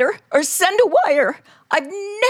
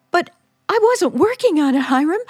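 normal talking voice

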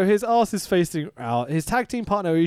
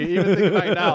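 ordinary delivery

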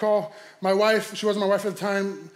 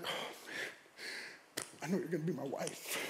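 whispered, near-silent realization; awed whisper; 4 semitones lower, 19 dB quieter, slower than usual. Retold or imitated. Driver hearing girlfriend's wise words, awed whisper. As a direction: breathe it out to yourself.

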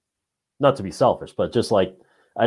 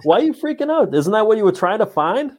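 mocking question; 12 semitones higher than usual. Mocking ghost hunters.

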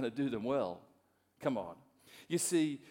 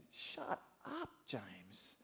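self-scolding; 4 semitones lower, 10 dB quieter, much slower than usual. Retold or imitated. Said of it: "stop myself and say".

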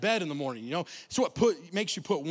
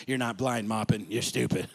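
much lower, blunt coach voice; gruff coach; 9 semitones lower than usual. Retold or imitated. Coach scolding the boy, low and blunt. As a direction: drop low and deadpan like the coach.

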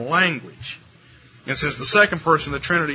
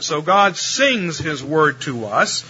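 normal talking voice

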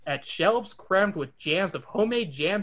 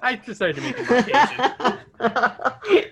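laughing; 7 semitones higher, 5 dB louder, slower than usual. Real, not acted. Laughing aside.

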